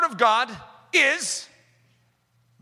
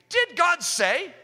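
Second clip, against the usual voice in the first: slow, much higher, sly tempter's question; sly serpent voice; 8 semitones higher, much slower than usual. Retold or imitated. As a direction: stretch it out like the sneering serpent. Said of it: "serpent quote voiced".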